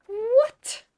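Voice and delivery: high, loud startled "What?"; sudden high shocked yelp; shocked cry. Real, not acted. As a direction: yelp in disbelief. Shock at plot twist.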